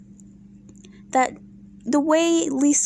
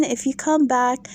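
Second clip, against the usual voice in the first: ordinary talk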